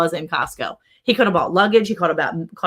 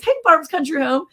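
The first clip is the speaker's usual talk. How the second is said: cheery shout-outs; 9 semitones higher than usual. Real, not acted. Greeting passing shops while driving.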